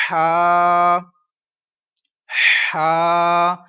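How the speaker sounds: हा pushed out loud from the middle of the throat; teacher sounding हा from the throat; throat ha demo. Shown as a demonstration. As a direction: breathe हा hard from the throat. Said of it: "ये आवाज लाजमी निकलवानी है".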